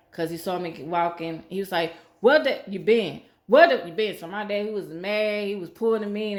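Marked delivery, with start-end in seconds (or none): 2.2-4.2 loud, furious shout at his daughter; angry yell. Retold or imitated. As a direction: bark it out like a mad father. Angry father yelling at daughter.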